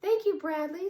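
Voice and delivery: a giggly stuffed bunny covering up; squeaky toy rabbit. A narrator's reading. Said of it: "said Ronnie".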